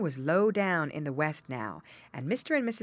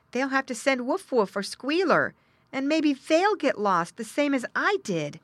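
high, louder little-pig voice; little pig, thinking aloud; 8 semitones higher, 5 dB louder than usual. Acted. Lost pig thinking of his brothers, little-pig voice. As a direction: voice the young pig, raised and high.

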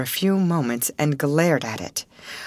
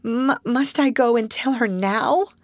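a touch of breathless fear; panting; 7 semitones higher than usual. A narrator's reading. Miss Amelia to her sister, breathless. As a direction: gasp the question. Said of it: "panted Miss Amelia".

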